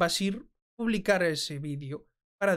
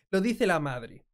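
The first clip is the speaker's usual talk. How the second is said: normal talking voice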